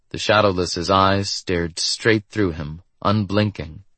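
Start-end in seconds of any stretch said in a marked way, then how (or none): none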